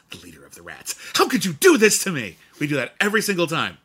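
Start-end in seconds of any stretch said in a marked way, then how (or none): 0.1-0.9 low, hushed echo; acted movie quote. Partners quoting The Secret of Nimh, low hushed reply. 1.2-2.4 loud, high mock anguish; melodramatic outcry. Partners quoting The Secret of Nimh, overwrought outcry.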